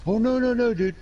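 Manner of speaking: very high, loud mock pleading; imitated backing down. Young vet backing off after being challenged, imitated high protest. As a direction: back off fast, in a high imitation.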